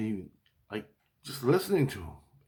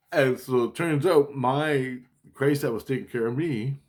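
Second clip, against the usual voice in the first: usual speaking voice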